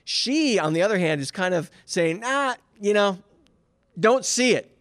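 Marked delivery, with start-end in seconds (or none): 2.2-4.7 higher, lightly mocking doubt; half-mocking disbelief, pitched higher. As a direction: scoff at it with a raised voice. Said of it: "almost in a mocking tone".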